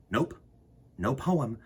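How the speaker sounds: plain reading voice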